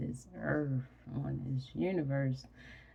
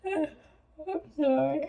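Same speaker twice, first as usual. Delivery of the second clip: high, slow words stretched by a yawn; real yawn; 12 semitones higher, 4 dB louder, much slower than usual. Real, not acted. Sleepy vlogger apologizing to viewers, yawning. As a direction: apologize through a big yawn. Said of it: "I don't know where that yawn come from".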